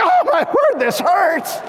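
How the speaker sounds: high, loud pained wail; pained outcry. Retold or imitated. Dad alone with his wife, wailing loudly in pain. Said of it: "I'm like".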